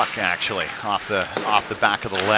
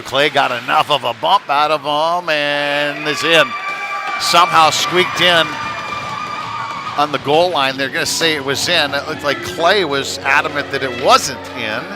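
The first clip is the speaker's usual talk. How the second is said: loud, higher-pitched play-by-play burst; excited goal call; 4 semitones higher, 6 dB louder than usual. Real, not acted. Announcer tells listeners Clay's bump got it in, excited. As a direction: call the goal loud, stretching the key words.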